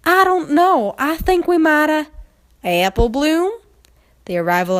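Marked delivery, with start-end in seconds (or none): 0.0-2.1 high, loud, breathless filly voice; scared filly. Acted. Apple Bloom answering friends, out of breath and scared. Filly panting after the chase. 2.7-3.5 lightly voiced puzzled older sister; ask, a little surprised; puzzled Applejack. Applejack calling her sister, lightly voiced and puzzled.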